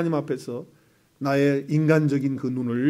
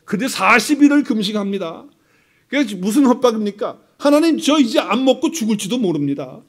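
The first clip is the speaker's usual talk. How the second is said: high, loud mock ultimatum to God; hammed-up threat to fast until death; mock threatening prayer; 5 semitones higher, 5 dB louder than usual. Believer threatening God with a fast, loud mock ultimatum. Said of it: "협박을 이렇게 하는 거예요".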